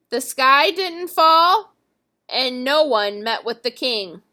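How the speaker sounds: plain reading voice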